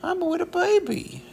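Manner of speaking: very high, loud squeaky dog voice; voicing the dog. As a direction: speak for the dog in a tiny high voice. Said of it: "She says".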